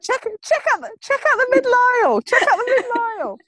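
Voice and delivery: high, fast, urgent squeal; excited car rat. Acted. Car rat urging a look at the middle aisle, excited. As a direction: rattle it out in giddy high-pitched excitement. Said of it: "getting all excited about a croissant".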